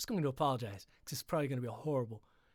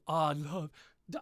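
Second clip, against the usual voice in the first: usual speaking voice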